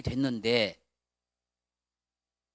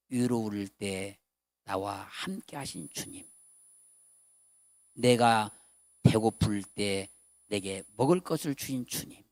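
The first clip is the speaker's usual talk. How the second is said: poem recitation; 9 semitones lower, slower than usual. Shown as a demonstration. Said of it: "시를 썼습니다".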